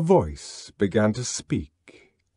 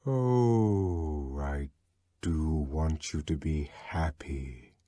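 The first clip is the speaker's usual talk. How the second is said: low, soft, slow and earnest; long for them, earnestly; yearning earnestness; 7 semitones lower, 8 dB quieter, slower than usual. A narrator's reading. Synthetic voice earnestly pleading with the rioters. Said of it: "with a yearning earnestness".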